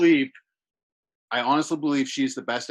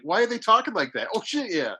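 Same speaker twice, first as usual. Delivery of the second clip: puzzled, higher-pitched question, then realisation; mock baffled, then catching on; retold confusion giving way to recognition; thrown off; 7 semitones higher than usual. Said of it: "I'm thrown off by it".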